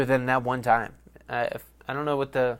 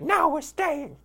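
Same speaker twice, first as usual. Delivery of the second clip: put-on much higher, stubborn little kid; bratty kid voice; slower than usual. As a kid refusing his dad, high bratty insistence. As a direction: play the defiant little kid. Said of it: "I was a little fucker".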